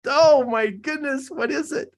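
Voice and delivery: high, drawn-out self-exasperation; real fluster, racking the memory for a name. Real, not acted. Still can't recall the name.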